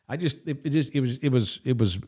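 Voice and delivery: usual speaking voice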